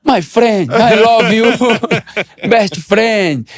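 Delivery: man gushing to feared captain, hammed-up fondness; effusive greeting. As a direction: lay the friendship on thick.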